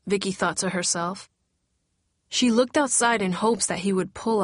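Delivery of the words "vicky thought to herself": ordinary delivery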